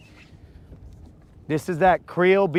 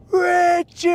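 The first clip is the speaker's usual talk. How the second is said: imitating a shout. Angler mimics his friend yelling his name across the water, high mock shout. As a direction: bellow the name like the friend did. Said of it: "you're over here telling me".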